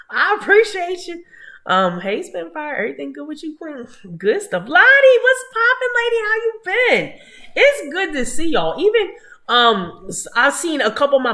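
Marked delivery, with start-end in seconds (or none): 0.1-1.2 playful voice. Real, not acted. Repeats thanks in put-on voice. 4.7-8.8 high, loud hype greeting; excited greeting. Real, not acted. Loud greeting to chat viewer.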